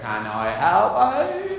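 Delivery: a lightly voiced, slow Norfolk old boy, low and soft; old Norfolk man. Old Norwich man greeting the TV crew, lightly voiced Norfolk accent. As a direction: answer the door like a drawling old Norfolk farmer.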